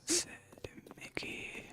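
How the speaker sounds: sussurro sem voz, arrastado; whispered eerily, very slow. Pretend. Whispered rhyme line.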